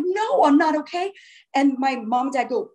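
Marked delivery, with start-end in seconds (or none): 0.0-1.1 higher-pitched, re-enacted upset; distressed reply. Shaken child answering worried parents, distressed. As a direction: protest in distress, as retold.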